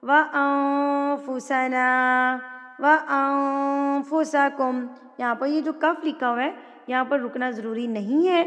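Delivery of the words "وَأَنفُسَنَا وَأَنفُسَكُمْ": slow, drawn-out chanted recitation; Quranic recitation. Shown as a demonstration. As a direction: recite with tajweed, long and measured. Recites the verse. (تلاوت کا انداز)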